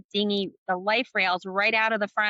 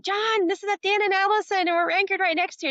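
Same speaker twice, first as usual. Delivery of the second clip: calling on the radio; 10 semitones higher than usual. Retold or imitated. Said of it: "were like".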